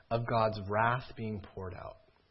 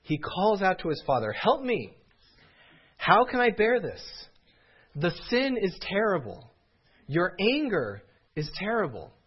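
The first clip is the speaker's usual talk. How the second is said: higher, louder pleading cry to a father; anguished cry; 5 semitones higher, 5 dB louder than usual. Acted. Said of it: "Jesus cries".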